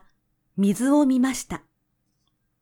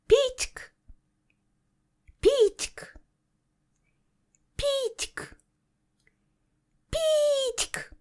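high, thin birdlike chirping; lark song; 5 dB quieter than usual. A narrator's reading. Skylark singing high above the rapeseed buds. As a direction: trill it like a skylark high in the sky. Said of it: "美しい声で歌い始めました".

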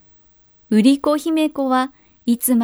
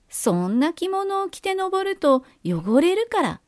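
sly sweet ogre; 6 semitones higher than usual. A narrator's reading. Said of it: "と言って".

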